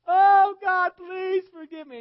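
huge high-pitched holler, words stretched out in anguish; hollered wailing. Retold or imitated. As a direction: imitate a woman hollering in torment at full volume. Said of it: "She'd holler out".

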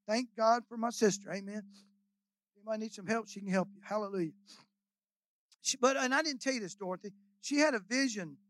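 ordinary talk, nothing marked

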